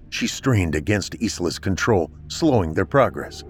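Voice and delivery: ordinary delivery, nothing marked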